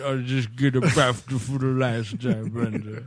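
low, soft, distant dragon voice. Retold or imitated. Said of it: "Can I do a George the Dragon".

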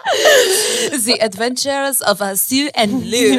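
high, slow announcement through laughter; laughing title. Real, not acted. Naming their trip adventure, announcing it through laughter. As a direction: giggle out the grand title.

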